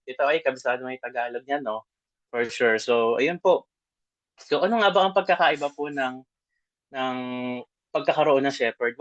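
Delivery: ordinary delivery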